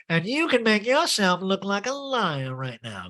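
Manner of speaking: lightly voiced Erica; mock Erica. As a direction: spell it out, pointed. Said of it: "Erica's like".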